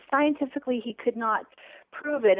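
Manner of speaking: ordinary delivery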